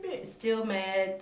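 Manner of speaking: normal talking voice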